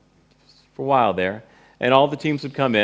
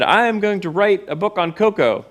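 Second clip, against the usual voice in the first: higher, eager declaration; excited announcement; 9 semitones higher, 4 dB louder than usual. Retold or imitated. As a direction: burst in full of news. Rushing home to tell girlfriend.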